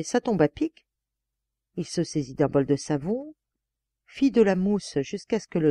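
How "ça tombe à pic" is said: raised pitch, lightly exclaimed; mild narrated exclamation; exclaiming. A narrator's reading. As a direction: exclaim it as a lucky find. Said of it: "s'exclama le barbier".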